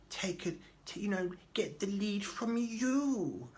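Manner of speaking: very high, slow put-on voice, partly whispery; exaggerated social worker. Social worker explaining caring approach to client, in high mock voice. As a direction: coo it in a high, drawn-out parody. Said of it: "I don't know why I'm talking like that".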